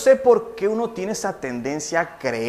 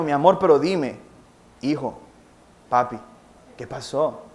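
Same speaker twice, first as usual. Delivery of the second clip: gentle coaxing father; 5 semitones lower than usual. Retold or imitated. Father coaxing sleepy son softly.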